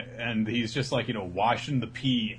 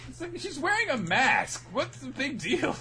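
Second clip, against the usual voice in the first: high, incredulous put-on voice; hammed-up high disbelief; mock exasperation; 14 semitones higher than usual. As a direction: scoff at the fuss. Mocking exasperated aside.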